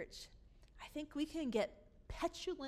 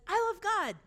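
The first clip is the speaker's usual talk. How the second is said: mock pious declaration; 8 semitones higher, 9 dB louder than usual.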